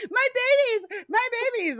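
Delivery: put-on retelling the old woman mourning her dogs, high shriek; mock old-lady scream. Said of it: "She's, like, screaming".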